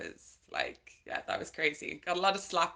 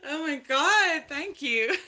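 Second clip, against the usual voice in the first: high, loud, bashful gush; flustered thanks; 10 semitones higher, 10 dB louder, slower than usual. Real, not acted. Flattered guest gushing loud and high at the host's intro. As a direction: caught off guard by praise, squealing thanks. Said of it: "I'm blushing".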